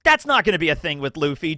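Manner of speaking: ordinary talk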